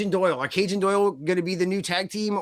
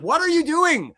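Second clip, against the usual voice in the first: incredulous outburst; 10 semitones higher, 5 dB louder, slower than usual. Retold or imitated. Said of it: "I was all like".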